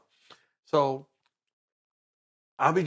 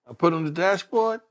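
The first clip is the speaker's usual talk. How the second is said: usual speaking voice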